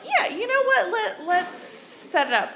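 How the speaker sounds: high-pitched, talked-into-it eagerness; acted sudden agreement. Comic retells agreeing to the blind date, acted eagerness. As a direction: start high, then ease down as you give in.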